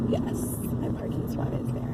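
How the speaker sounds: whispered relief to herself. Real, not acted. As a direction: breathe it out under her breath. Whispered aside to self.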